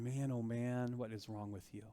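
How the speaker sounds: Jesus sighing at his followers; weary sigh. Retold or imitated. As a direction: let it fall away on a sigh of disappointment. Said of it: "That deep sigh and going".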